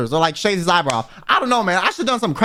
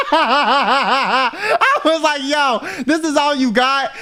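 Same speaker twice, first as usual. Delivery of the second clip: laughing; 6 semitones higher, much slower than usual. Real, not acted. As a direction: talk through real laughter, higher. Laughing at the weak punch.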